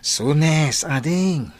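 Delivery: loud playful teasing to the younger sibling; older brother loudly teasing his sister about marriage; teasing call. Acted. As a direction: call it out loud to tease her.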